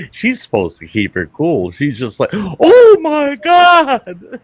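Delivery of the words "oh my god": very high, loud and drawn-out shriek of shock; shocked exclamation. Retold or imitated. Receptionist seeing the man's bloody backside, drawn-out shocked shout. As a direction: gasp it out as if you just saw blood everywhere.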